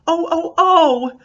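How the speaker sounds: high, loud, drawn-out oh's of delight; delighted ohing. Acted. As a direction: gush with thrilled little oh's. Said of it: "She stood there "ohing".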